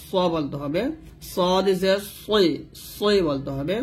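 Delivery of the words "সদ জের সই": "সদ জের সই" very slow, thick s; heavy Arabic sad. Shown as a demonstration. Teacher showing learners heavy sad, thick "সই". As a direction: stretch "সদ", darken the s of "সই". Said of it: "সদ মোটা করতে হবে".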